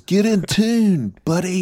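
high, slow drawn-out heckle; hammed-up shout from the audience; mock heckler yell. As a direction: holler it at the stage in pretend disgust. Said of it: "Somebody just yells".

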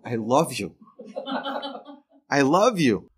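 laughing between the words; tickled, laughing declaration of love; amused gratitude. Retold or imitated. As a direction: say it through a chuckle. Laughing through his grateful drive home.

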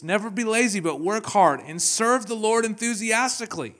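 high and loud, every word pushed; rally them to work hard; 1 exhortation at full force. Acted. Preacher urging the church to serve the Lord.

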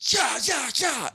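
high mock yell of yeah; imitated yell. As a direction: yelp it to scare a bear. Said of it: "the guy just goes".

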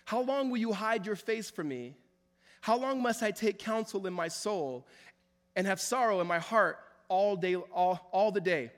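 psalm read at full preaching force; impassioned reading. Acted. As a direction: read the lament out like a plea. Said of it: "he says".

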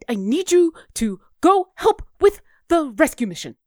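high, fretful little owl voice; flustered Bucks. Acted. Owl familiar asking the party to rescue a friend, flustered. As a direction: play a worried, slightly posh small creature. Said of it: "You hear Bucks say".

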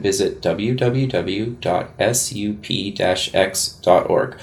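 usual speaking voice

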